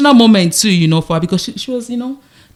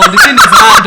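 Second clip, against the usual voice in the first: laughing; 2 semitones higher, 6 dB louder than usual. Real, not acted. Laughs at own accent.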